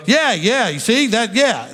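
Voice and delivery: mock eager agreeing; eager agreement. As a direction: jump in as the person who feels proven right. Acted eager reply of validated person.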